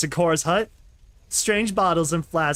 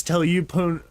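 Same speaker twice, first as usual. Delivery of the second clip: ordinary delivery, nothing marked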